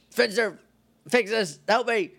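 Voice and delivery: high-pitched cry for help, acted; hammed-up begging to be rescued; urgent plea. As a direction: call out in mock desperation.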